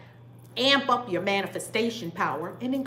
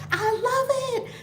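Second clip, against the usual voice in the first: much higher, slow and gleeful; delighted; slower than usual. Real, not acted. Reacting to a viewer's comment, high and gushing. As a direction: gush over it.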